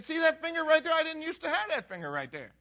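high-pitched eager show-and-tell; acted excitement, voice lifted high; excited, thrilled. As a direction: point it out like a proud miracle. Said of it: "but he's excited. He's thrilled".

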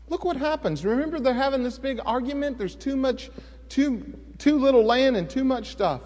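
ordinary talk, nothing marked

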